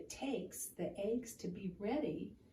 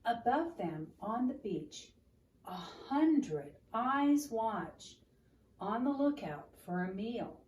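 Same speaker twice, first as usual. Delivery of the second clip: story reading; 6 dB louder than usual. A narrator's reading. Reads picture book aloud.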